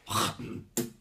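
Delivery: harsh throaty rasp, as if about to spit; hawking "kh" noise; throat-clearing H. Shown as a demonstration. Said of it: "sounds like you're clearing".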